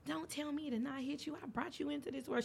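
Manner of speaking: lightly voiced mother, indignant and hushed; mom scolding. Mom scolding her grown daughter, lightly voiced indignation.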